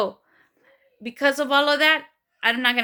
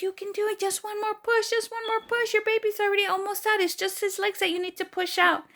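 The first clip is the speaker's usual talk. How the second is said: high, singsong coaxing; encouraging nurses; 9 semitones higher than usual. Retold or imitated. Nurses urging one more push, imitated encouragement. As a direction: coax in a high cheerleading voice. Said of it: "the nurses were like".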